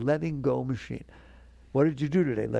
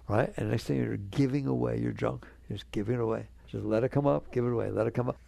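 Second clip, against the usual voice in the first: ordinary delivery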